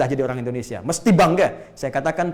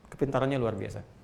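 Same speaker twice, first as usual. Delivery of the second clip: ordinary delivery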